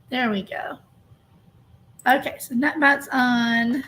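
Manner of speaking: ordinary delivery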